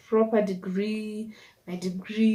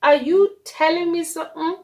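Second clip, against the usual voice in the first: loud high shocked appeal; a touch of mock shock, higher and louder; 7 semitones higher, 5 dB louder than usual. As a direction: cry out to God in disbelief. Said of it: "I'm like".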